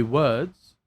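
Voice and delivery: "words" with no R sound, like "wuds"; R left unrolled. Shown as a demonstration. Showing the effortful neutral accent, "words" without its R. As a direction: say "words" carefully, dropping the R. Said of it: "roll my R".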